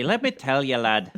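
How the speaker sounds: lilting Irish brogue, slowed; a playful stab at an Irish lad; Irish accent. Pretend. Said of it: "I could do an accent kind of".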